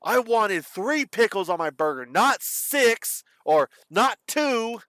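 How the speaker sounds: high, slow, fussy customer voice; whiny, drawn-out picky customer; demanding customer. Retold or imitated. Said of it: "You get some crazy people".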